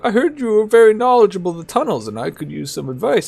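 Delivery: high mocking old man voice; mock the old man's voice. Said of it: "mocking him".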